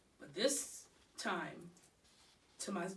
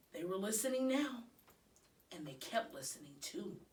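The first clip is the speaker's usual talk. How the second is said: ordinary delivery, nothing marked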